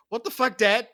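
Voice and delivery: shouted high and loud at dad; a son yelling in shock at his father; mock outraged yell.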